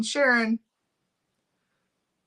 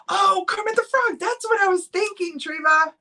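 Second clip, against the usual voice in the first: delighted squeal; 10 semitones higher, 4 dB louder than usual. Real, not acted. Delighted at a viewer's comment.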